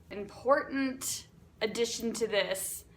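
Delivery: ordinary talk, nothing marked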